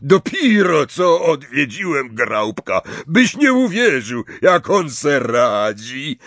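cheerful giant, drawing the words out; Hagrid. Acted. Friendly giant tells Harry about his visit, delighted.

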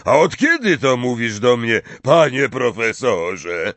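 big indignant character voice; gruff giant retort. A narrator's reading. Hagrid retorts to pointed title.

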